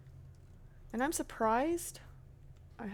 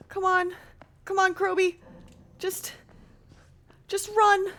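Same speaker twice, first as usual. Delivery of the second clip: high, louder urging herself on; urgent self-encouragement; 6 semitones higher, 3 dB louder than usual. Real, not acted. Gamer urging herself to run past danger, tense and loud. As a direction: push yourself to just run.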